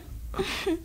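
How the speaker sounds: short high teasing giggle; a coy little laugh to end the tease. Acted. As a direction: laugh at him playfully, under the breath. She teases him, then laughs.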